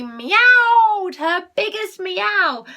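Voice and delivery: high, loud, very slow; biggest meow. A narrator's reading. Reading to a child, biggest loudest meow.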